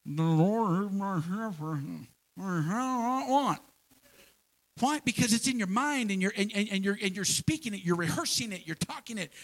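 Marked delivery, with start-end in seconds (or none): 0.1-3.6 slow, muffled recital with a toothbrush in the mouth; recited while brushing teeth. Pretend. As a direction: say it slowly mid tooth-brushing. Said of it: "you go to brush your teeth and".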